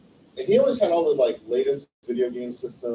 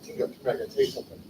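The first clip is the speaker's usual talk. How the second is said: fast hushed whisper; sneaky whisper to a friend at dinner; conspiratorial whisper; 8 dB quieter, much faster than usual. Retold or imitated. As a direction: whisper it quickly so nobody else hears. Secret told at a family dinner.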